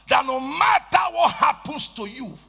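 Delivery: normal talking voice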